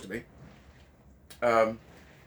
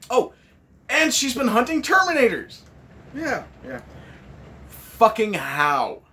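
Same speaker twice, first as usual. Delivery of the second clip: mocking disbelief; 7 semitones higher, 4 dB louder, slower than usual.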